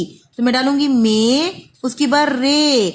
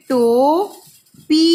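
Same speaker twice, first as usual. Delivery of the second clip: drawn-out sounding; 3 dB louder, much slower than usual. Shown as a demonstration. Teacher sounding out टोपी slowly for pupils. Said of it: "देखिए टोपी कैसे लिखेंगे".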